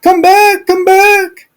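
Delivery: high, pleading father's voice. Retold or imitated. Father begging his angry older son to come home, high and pleading. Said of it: "He said, pleaded".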